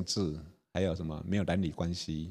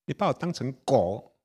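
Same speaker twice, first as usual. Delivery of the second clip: usual speaking voice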